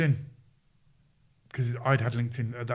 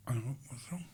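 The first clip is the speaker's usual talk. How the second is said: whispered, unvoiced question; hushed kids; 11 dB quieter than usual. Kids whisper nervously to their coach, hushed and confused. Kids stood there going.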